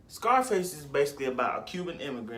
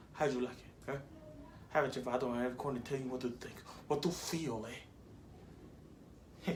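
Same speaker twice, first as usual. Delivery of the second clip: put-on Tony Montana accent; 8 dB quieter, faster than usual. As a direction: quote it quickly in a put-on Cuban immigrant accent. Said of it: "say it in my Tony Montana accent".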